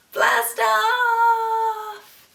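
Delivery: high, loud sung blast-off; excited launch cry. Acted. Teacher ending the countdown for children, high excited cry. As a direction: shout it like the rocket leaves.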